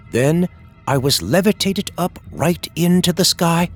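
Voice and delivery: plain reading voice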